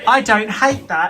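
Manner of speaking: imitating Glenda. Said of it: "did you get that reaction".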